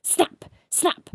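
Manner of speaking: ordinary delivery, nothing marked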